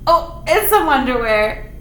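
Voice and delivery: plain reading voice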